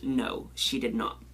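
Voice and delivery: ordinary talk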